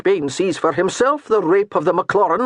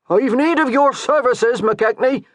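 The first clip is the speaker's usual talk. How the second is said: loud call over the wind; bellowing; 7 semitones higher, 4 dB louder than usual. A narrator's reading. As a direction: bellow it across a windy yard. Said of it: "Kelmot bellowed over the rising wind".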